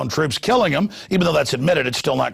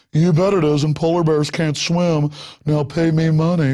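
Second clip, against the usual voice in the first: mocking Al Gore. Al Gore smugly asking for money, mock con man. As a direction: sell it like a huckster. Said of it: "And Al Gore's like".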